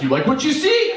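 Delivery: high, cocky flirt line; cheesy flirt. Retold or imitated. To his wife while flexing, a high cocky flirt. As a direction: show off like a bodybuilder. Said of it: "And I'm like".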